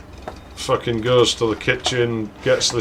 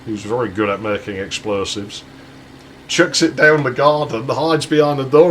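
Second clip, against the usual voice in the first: normal talking voice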